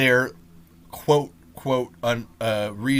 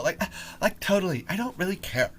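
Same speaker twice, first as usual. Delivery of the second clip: mock Valley girl; 6 semitones higher, 4 dB quieter than usual. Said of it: "You sound like a Valley girl".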